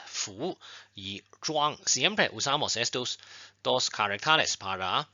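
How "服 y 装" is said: 服 y 装 said very slowly, each syllable drawn out and clearly toned; slow model pronunciation. Shown as a demonstration. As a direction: spell out 服 and 装 slowly for learners. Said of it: "vamos a aprender dos palabras". (pronunciación lenta y clara de 服 y 装)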